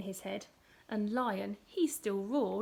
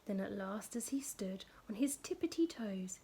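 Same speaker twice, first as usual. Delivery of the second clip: suspenseful whisper; 3 semitones lower, 7 dB quieter than usual. A narrator's reading.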